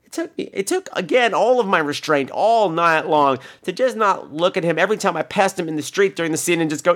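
ordinary talk, nothing marked

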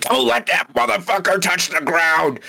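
hollering it out like a rowdy fan; imitated hollering. Retelling a concert guy hollering about the flag, mock yell. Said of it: "like, hollering".

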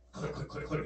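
soft, dry clicking of an empty gun; imitated gun clicks. Shooter runs out of bullets, imitated empty clicks. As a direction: click it out quietly, like a spent pistol.

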